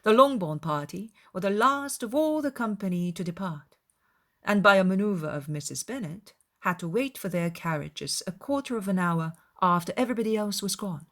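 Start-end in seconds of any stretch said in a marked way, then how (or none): none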